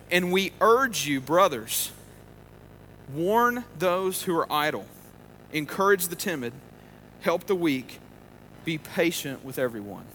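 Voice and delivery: slow, deliberate scripture reading; measured reading of scripture. Acted. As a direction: read the verse slowly, weighing each line. Reads scripture aloud.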